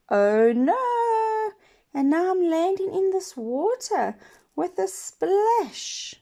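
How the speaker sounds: high, loud crying "oh no", slowing after; pirate crying in the water. Acted. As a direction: wail like a frightened pirate. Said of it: "And he hears in the water".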